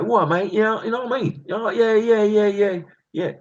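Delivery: high hammed-up Cockney accent; mock Cockney. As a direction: rattle off the yeahs like a Cockney geezer. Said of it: "I'd probably be Cockney".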